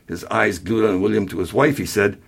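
plain reading voice

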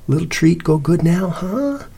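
high, sing-song pet talk; coaxing. A narrator's reading. Cece offering the dog a treat, coaxing. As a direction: coax the dog, lifting right up on the question. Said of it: "Cece's voice went on".

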